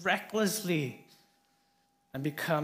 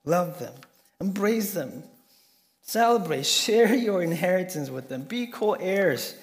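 fervent preaching; 4 semitones higher, 6 dB louder than usual. Acted. Preaching Jesus' plea to accept.

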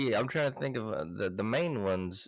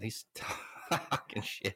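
laughing; 5 dB quieter than usual. Real, not acted. Laughing remark.

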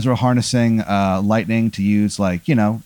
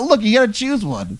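much higher, put-on voice; high character voice; 11 semitones higher than usual. Strangers demand a choice from Aaron, high put-on voice. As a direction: pipe it up high as you press for an answer. Men pressing Aaron to choose.

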